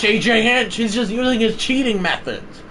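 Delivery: usual speaking voice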